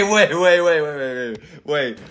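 normal talking voice

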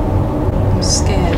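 whispered nervous admission; scared whisper. Real, not acted. Hiding in the game, a scared whisper. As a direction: breathe it out afraid.

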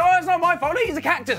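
pleading. Retold or imitated. His own pleading retold.

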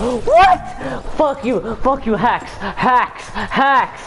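shouting furious insults, high and loud; mock rage. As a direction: yell at the screen like a raging gamer. Fake rage at a hacker, then joking.

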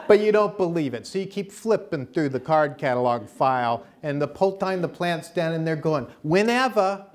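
high, loud Chicago accent impression. Herbalist from Chicago explaining plants to listeners, loud Chicago accent. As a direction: do Rosita's brassy Chicago accent. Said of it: "So she talks like this".

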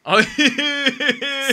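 giggle. Real, not acted. Giggling at the Kansai joke.

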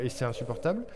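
usual speaking voice

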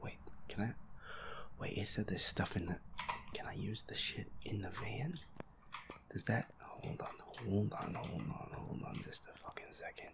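normal talking voice

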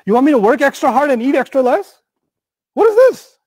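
high, incredulous mock outburst; hammed-up outrage, pitch leaping at the end; indignant protest. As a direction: object loudly in disbelief, climbing to a squeal. Acts out people protesting the policy.

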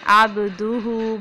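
عَبْدُهُ with bouncing qalqala on ba; correct recitation. Shown as a demonstration. Teacher demonstrating qalqala. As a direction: echo the ba in عَبْدُهُ. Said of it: "با پہ قلقلہ ہوگا".

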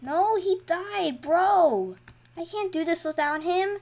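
high, drawn-out lament; dismayed whine. Real, not acted. Player laments that they can't go on without him, whiny dismay. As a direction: wail over a fallen teammate. Teammate died in game.

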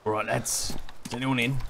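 put-on London geezer accent; casual cockney lad voice; British accent. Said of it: "this voice is killing me".

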